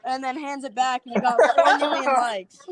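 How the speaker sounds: ordinary talk, nothing marked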